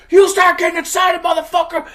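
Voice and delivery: high, loud retort; hammed-up furious shout; frantic angry yell. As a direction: yell back in a panic, acting it out. Rocco yells back, acted.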